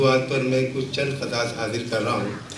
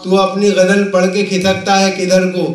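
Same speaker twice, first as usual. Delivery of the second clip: measured, projected Urdu verse recital; comic poet reciting with rhythmic phrasing; mushaira recitation; 7 semitones higher, 7 dB louder than usual. Acted. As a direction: declaim the couplets to a live audience, line by line.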